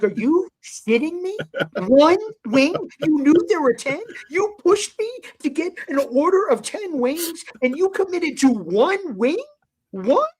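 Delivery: high, loud, exasperated disbelief; incredulous outrage. Retold or imitated. Scolding friend who ate one wing, incredulous. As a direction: punch each question in comic indignation.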